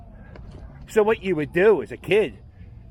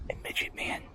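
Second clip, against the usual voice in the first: hushed whisper, barely any voice; secretive whisper about a neighbor; 10 dB quieter than usual. Real, not acted. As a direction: whisper it so nobody nearby hears. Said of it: "there's a reason why I'm whispering".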